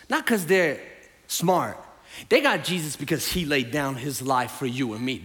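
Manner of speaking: ordinary talk, nothing marked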